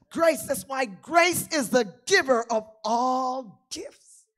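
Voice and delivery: loud, slow, stretched proclamation; preaching at full force. Acted. Pastor preaching over the organ, loud and slow. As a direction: ring it out over the music.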